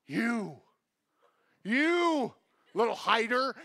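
loud, high, drawn-out scolding; mock furious parent hunting for a name; super angry parent. Parent finding a hidden child, mock-angry scolding. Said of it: "you're super angry".

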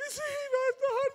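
high, slow weepy "Sí, pastor"; high, slow voice of a sobbing church member; tearful woman. Retold or imitated. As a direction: answer like a crying woman, drawn out and high.

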